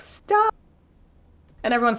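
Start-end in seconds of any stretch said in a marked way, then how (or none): 0.0-0.5 high ringing command; mock royal command; commanding stop. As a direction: halt the whole joust with one word. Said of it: "Gabriel's like".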